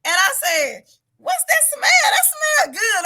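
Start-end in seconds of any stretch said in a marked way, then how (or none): 1.2-3.0 high, loud, eager exclamation; excited child. Retold or imitated. Trapped girl asks the man about his cooking, high excited mimicry. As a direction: imitate a kid excited by a cooking smell. Child sniffing food in retold story.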